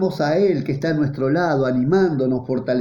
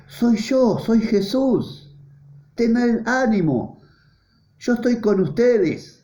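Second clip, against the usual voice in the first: higher, slow and gently reassuring; reassuring Jesus; 8 semitones higher, slower than usual. Acted. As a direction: lift the voice and calm frightened friends. Preacher voicing Jesus reassuring disciples.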